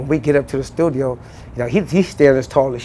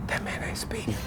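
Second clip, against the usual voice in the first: breathy whisper; whispered tip-off; 20 dB quieter than usual. Retold or imitated. Crew quietly telling Suge, whispered. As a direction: hush it to the boss.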